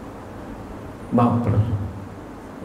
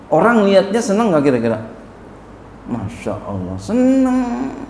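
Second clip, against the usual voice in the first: excited rhetorical question; 7 semitones higher than usual. Real, not acted. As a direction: fire it at them and answer yourself. Asking crowd, answering himself.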